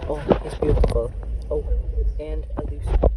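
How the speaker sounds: hushed, awed wildlife presenter voice; nature documentary. Acted. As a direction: marvel at the frog like a TV naturalist. Spoofing a wildlife documentary host.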